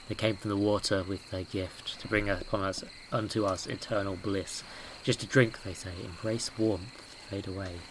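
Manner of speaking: hushed, eerie reading of a note. Acted. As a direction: read it like a creepy cult verse. Reads cult note aloud.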